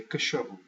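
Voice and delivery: CACHORRO with stress on CHO; middle-syllable stress. Shown as a demonstration. Teacher showing what you say, middle-syllable stress. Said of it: "you say".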